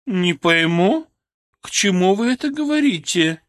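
медленно, растерянно, чужим голосом; растерянная оперная дива; растерянный голос. A narrator's reading. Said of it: "растерянный голос оперной дивы". (озадаченная дива, медленно)

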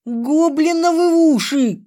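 высокий протяжный крик с руганью; выкрикни ругательство, как от боли; громкая ругань. A narrator's reading. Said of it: "так громко ругалась". (истошная брань)

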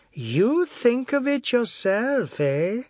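high, slow mocking echo; sarcastic challenge. A narrator's reading. Husband doubting wife's own idea, sarcastic challenge. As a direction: draw it out in scornful disbelief.

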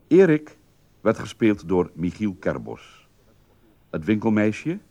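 ordinary talk

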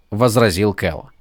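ordinary delivery, nothing marked

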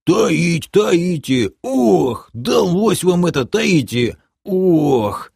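high, groaning character voice; aggrieved high-pitched whining; indignant half-orc. A narrator's reading. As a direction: grumble in protest with moaning sighs. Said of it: "возмутился полуорк Ишак".

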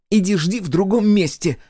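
loud, high-pitched snap; angry snarl. Acted. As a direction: snarl at him to clear off. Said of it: "рыкнула она". (злой громкий рык на высоких нотах)

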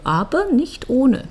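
usual speaking voice

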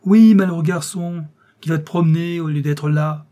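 exasperated outburst. A narrator's reading. Uncle bursts out at nephew.

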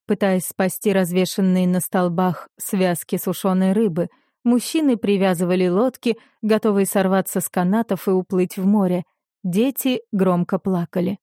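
ordinary delivery, nothing marked

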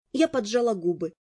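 usual speaking voice

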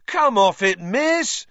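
high, loud outraged howl; howled protest. A narrator's reading. Fred protests to Madam Hooch, howled protest. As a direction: protest the call. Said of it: "howled Fred".

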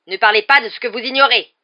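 high, furious full shout; deranged shouting. A narrator's reading. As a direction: explode at them like a madman. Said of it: "s'écria Rogue qui avait soudain l'air d'un dément". (hurlement de dément)

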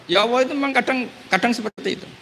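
high pleading voice of a sinner. Retold or imitated. Sinner in hell answering God, high pleading voice.

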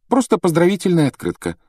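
high-pitched, hurried dismissal; lightly voiced girl covering up; hasty reply. A narrator's reading. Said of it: "поспешно ответила Гермиона".

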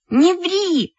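high, indignant cry. A narrator's reading. Lyuska denies staining the book, crying out. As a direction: snap back high, no extra volume. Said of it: "закричала Люська".

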